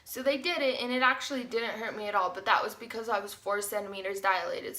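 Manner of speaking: ordinary talk, nothing marked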